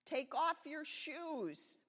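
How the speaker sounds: loud, high, slow command; big commanding storybook voice; thundering voice. A narrator's reading. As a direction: thunder it out slowly like God. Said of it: "the voice thundered".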